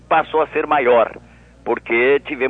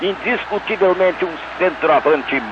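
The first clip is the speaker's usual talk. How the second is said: veteran radio goal narration; radio play-by-play; 5 semitones higher than usual. Shown as a demonstration. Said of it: "Faz pra nós, [name]".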